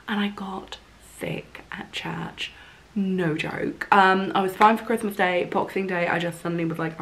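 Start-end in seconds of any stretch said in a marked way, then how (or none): 0.1-2.5 slow whispered reveal, much softer; confiding whisper. Real, not acted. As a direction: drop to a whisper for the punchline. Punchline whispered for effect.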